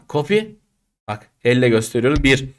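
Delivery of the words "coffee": "Coffee?" rising high and softer; rising question. Shown as a demonstration. Teacher showing students body language, rising "Coffee?". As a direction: lift "Coffee?" upward gently. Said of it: "Bak beden dili var mı".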